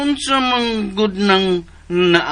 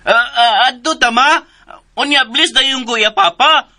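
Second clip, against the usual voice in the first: eager pleading; 8 semitones higher, 6 dB louder than usual. Acted.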